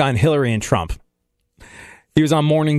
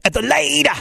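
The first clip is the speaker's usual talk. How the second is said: slow, higher, growly metal singer giving directions; 10 semitones higher, much slower than usual. Retold or imitated. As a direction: drag the words like a metal frontman. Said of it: "[name] ... directions to his house".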